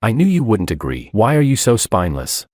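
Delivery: usual speaking voice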